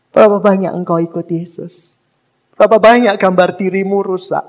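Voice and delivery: usual speaking voice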